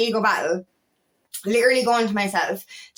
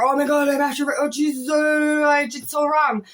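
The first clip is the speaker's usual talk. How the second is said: higher, anxious spiralling voice; mock panic; 3 semitones higher than usual. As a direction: play at fretting over a mistake. Acts out anxious overthinking.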